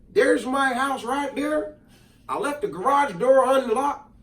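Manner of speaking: hammed-up Southern neighbor offering help; country neighbor voice. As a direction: play the kind Southern neighbor, easygoing. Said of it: "He probably didn't talk like that for one".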